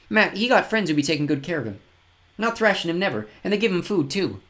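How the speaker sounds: put-on high, earnest little boy voice; a grubby child proudly telling the news; fen tyke. A narrator's reading. Tyke tells of Matt.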